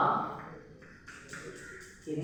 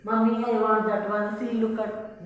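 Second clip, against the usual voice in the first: put-on higher, quick child's plea; child pointing; 5 semitones higher than usual. Teacher acting a child tugging at mummy, quick and higher. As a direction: whine like a small child wanting a toy. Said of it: "this is what?".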